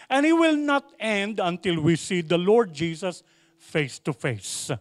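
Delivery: normal talking voice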